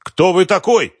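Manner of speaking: high, loud furious yell into the phone; angry shout. Acted. Businessman shouting at investigator over the phone, furious shout. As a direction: bark the question in outrage. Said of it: "Гневный крик Концевича".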